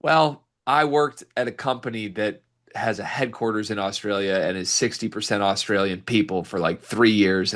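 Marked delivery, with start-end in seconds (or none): none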